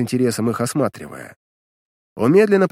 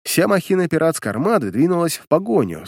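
plain reading voice

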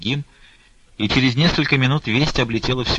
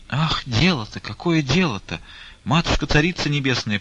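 raised, plaintive lament; a mild narrated lament, pitched up; 6 semitones higher than usual. A narrator's reading. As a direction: wail it to the heavens. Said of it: "причитала мать Таифа".

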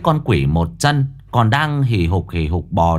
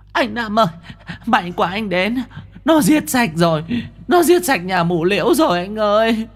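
put-on high, breathless pleading girl voice; frightened ghost girl; 12 semitones higher than usual. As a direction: beg her savior in panic.

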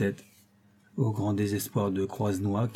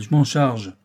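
louder, boastful exclamation; exclaiming; 3 semitones higher, 6 dB louder, slower than usual. A narrator's reading. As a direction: claim the task with swagger. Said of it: "s'écria-t-il".

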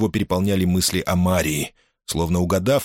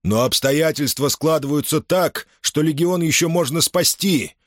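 shouting; 9 semitones higher than usual. A narrator's reading. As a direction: proclaim it to the whole legion. Pompey keeps shouting at legion.

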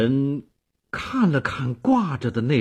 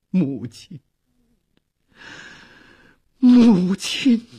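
emotional calling; 6 semitones higher, 4 dB louder, slower than usual. Acted. Kou Zhun before his late mother's portrait, emotional calling. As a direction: call to mother, the second far higher and louder. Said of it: "喃喃地呼唤着".

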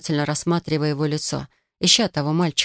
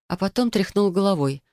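ordinary delivery, nothing marked

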